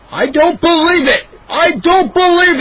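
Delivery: high, loud, drawn-out wail of disbelief; anguished cry. A narrator's reading. Neighbor wailing at his ruined melons, high and loud. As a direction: cry out over the wrecked garden. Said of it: "Mister McCall's cries".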